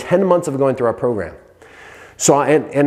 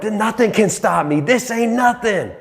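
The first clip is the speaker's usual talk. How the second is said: fired-up boast; 9 semitones higher than usual. Retold or imitated. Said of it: "I'm like".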